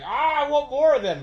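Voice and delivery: high, loud exaggerated craving; mock gushing. Co-host mocking other reviewers' praise, gushing loudly. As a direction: imitate an over-excited fan, hammed up.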